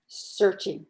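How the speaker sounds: usual speaking voice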